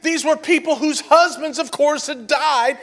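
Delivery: loud, high preacher's build, hammering each point; preaching at full force. Acted. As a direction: preach it hard to the congregation.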